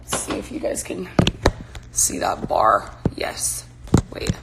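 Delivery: whispered under breath. Real, not acted. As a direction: whisper it to yourself. Checking the camera view quietly.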